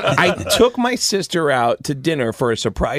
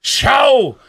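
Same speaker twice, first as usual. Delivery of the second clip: high, loud, slow squawking shout; Gilbert Gottfried; 7 semitones higher, 3 dB louder, much slower than usual. Acted. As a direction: yell every word like the cranky comic. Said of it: "Gilbert Gottfried".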